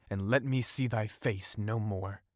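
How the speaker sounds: ordinary delivery, nothing marked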